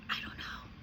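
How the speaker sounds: unvoiced whisper back; answering whisper to a friend. A narrator's reading. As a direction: breathe it out with no voice. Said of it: "Matilda whispered back".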